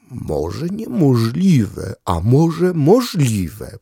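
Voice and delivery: very slow, hesitant dog voice; doubtful dog. Acted. Dog full of doubt, drawing the words out slowly. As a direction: weigh each word like an unsure dog. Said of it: "powiedział, ma wątpliwości".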